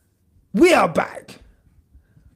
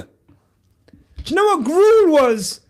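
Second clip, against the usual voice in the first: usual speaking voice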